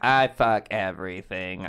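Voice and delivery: mope like a broken robot; dumb robot voice. Pretend. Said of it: "It's the robot".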